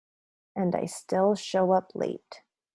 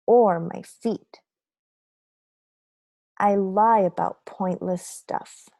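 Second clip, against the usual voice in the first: ordinary delivery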